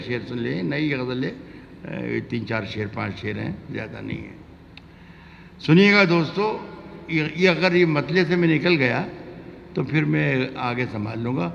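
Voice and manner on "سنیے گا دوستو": calling out. Real, not acted. Poet calls out to audience.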